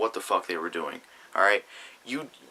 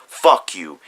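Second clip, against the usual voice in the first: angry; 2 semitones lower, 9 dB louder than usual. Real, not acted. Ranting at rival.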